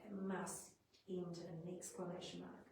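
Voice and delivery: ordinary talk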